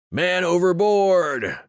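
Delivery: high, long, far-carrying cry of alarm; alarmed shout. Acted. Soldier raising the alarm from the stern, full shout. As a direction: bellow it across the water as a warning. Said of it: "The shout cracked through the morning air".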